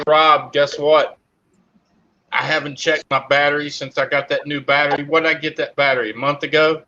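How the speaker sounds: usual speaking voice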